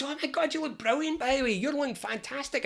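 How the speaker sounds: airy, high friendly compliments; gushing friends. Retold or imitated. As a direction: fawn over them sweetly. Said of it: "Everybody goes".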